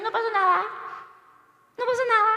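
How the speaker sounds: put-on high, bright "no pasa nada" to a toddler; cheerful reassurance. Adults cheering a fallen baby, high and encouraging. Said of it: "todo el mundo le dice".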